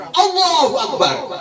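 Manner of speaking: high, drawn-out rousing Allahu Akbar; battle cry. Shown as a demonstration. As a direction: call it out like a war commander. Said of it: "jadi komandan perang".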